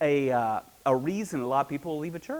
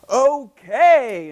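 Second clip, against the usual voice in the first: much higher, louder, bright agreement; eager okay; 8 semitones higher, 10 dB louder than usual. Retold or imitated. New believer agreeing to join, eager. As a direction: jump at the offer.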